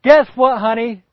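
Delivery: high, loud burst of news; excited Abraham. Acted. Abraham bursting into the tent to tell Sarah, thrilled. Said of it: "Abraham bursts through the tent and goes".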